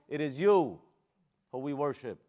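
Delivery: loud, drawn-out stress on "you"; emphasis on "you". Shown as a demonstration. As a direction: punch "you", then let the rest settle. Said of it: "we're not doing that about ourselves".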